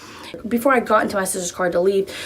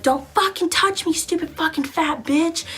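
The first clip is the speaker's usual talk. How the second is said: retold angry yell; 8 semitones higher than usual. Cornered in car, yelling back.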